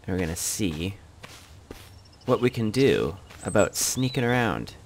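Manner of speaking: normal talking voice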